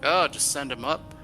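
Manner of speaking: ordinary delivery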